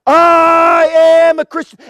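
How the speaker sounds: long drawn-out, high shout; acted martyr's defiant proclamation; bold declaration. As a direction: cry it out to a whole arena, stretching the words. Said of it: "he just answered with".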